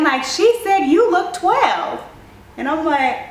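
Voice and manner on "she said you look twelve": high, loud and slow blurt; loud high slow outburst; shouting across the table. Retold or imitated. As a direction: announce it to the whole table. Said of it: "I must have shouted across the table again".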